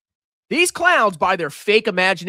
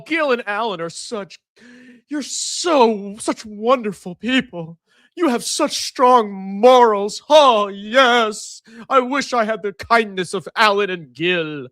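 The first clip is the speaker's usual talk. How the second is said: sarcastic praise; 3 semitones higher, slower than usual. Pretend. Vlogger mocks commenters Gil and Alan with sarcastic praise. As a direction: fake sweet compliments.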